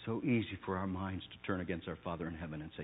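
ordinary delivery

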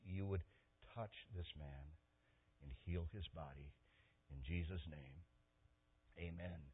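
soft, hushed healing prayer; quiet prayer. Retold or imitated. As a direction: pray gently over the sick man. Prays over the sick man.

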